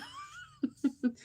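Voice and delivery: a short, high, real giggle. Real, not acted. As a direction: giggle at yourself, embarrassed. Laughs at her bad name memory.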